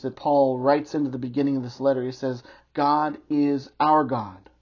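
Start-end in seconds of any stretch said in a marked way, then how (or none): 2.7-4.5 "our" drawn out and punched; slow right down, hit "our" firmly; stress on OUR. Real, not acted. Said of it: "He emphasizes the word "our".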